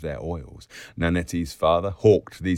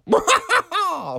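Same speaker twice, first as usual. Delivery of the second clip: high full-throated laughing yell; piercing cackle; yell of laughter; 3 dB louder than usual. A narrator's reading. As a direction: let rip a splendid whoop. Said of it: "full vent to a splendid yell".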